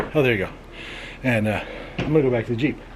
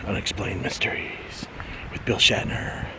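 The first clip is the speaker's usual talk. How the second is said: dramatic hushed show title; whisper; 3 semitones lower, 6 dB quieter, slower than usual. Retold or imitated. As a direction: breathe it like a TV mystery.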